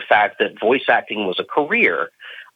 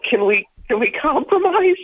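excited plea. Retold or imitated. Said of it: "I was like".

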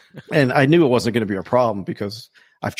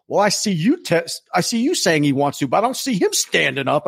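higher-pitched mock challenge; goading announcer; 6 semitones higher than usual. Announcer goading a seated man, raised taunting voice. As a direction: imitate a host needling someone in the crowd. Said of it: "the announcer goes".

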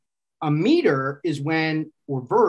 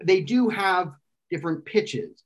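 ordinary delivery

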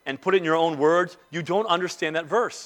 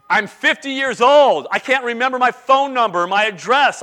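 higher-pitched mock protest; protesting objection; 5 semitones higher, 4 dB louder than usual. Congregant objecting to memorizing scripture, mock protest. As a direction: object as an exasperated listener would.

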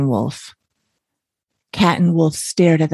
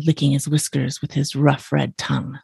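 ordinary delivery, nothing marked

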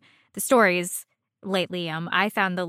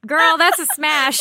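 sassy, louder verdict; 5 semitones higher, 6 dB louder than usual. Real, not acted. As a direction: tell her like a bestie.

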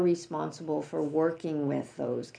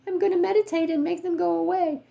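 much higher and fast, a bright naive eagerness; mock naive meditator; 11 semitones higher, 4 dB louder, faster than usual. Teacher mocking spiritual bypassing, high and fast naive voice. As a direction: parody someone sure meditation will fix everything. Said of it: "Bypassing is".

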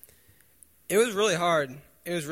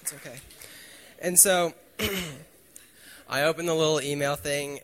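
usual speaking voice

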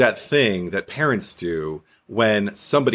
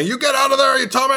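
high, louder mock yell at the kid; retold father's yell; 13 semitones higher, 3 dB louder, faster than usual. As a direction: yell at the boy in the boat from the dock. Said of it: "he's yelling at me".

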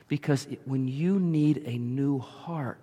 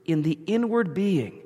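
raised, earnest pleading to God; fervent prayer voice; 6 semitones higher, 4 dB louder than usual. Acted. Preacher voicing David's prayer, fervent and raised. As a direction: preach the psalm as a heartfelt prayer.

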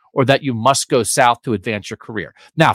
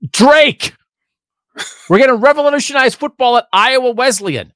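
mock hype; 10 semitones higher, 6 dB louder than usual. Hyped mock coach announcement.

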